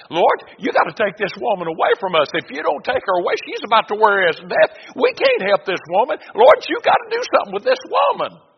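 high, loud, mounting complaint; exasperated disciples. Acted.

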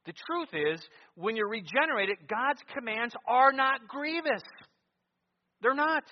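loud pulpit declaration climbing high; impassioned, high-pitched proclamation; full-force preaching. Real, not acted. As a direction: build to an emphatic cry.

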